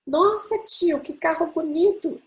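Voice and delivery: put-on a child marvelling at the car; little boy's voice. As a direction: squeal like an amazed small boy. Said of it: "que voz dele".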